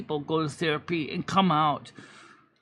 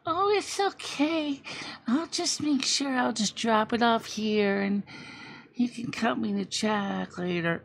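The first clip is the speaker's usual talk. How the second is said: sweet Michelle; 3 semitones higher, 5 dB quieter than usual. Retold or imitated. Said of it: "Michelle would be like".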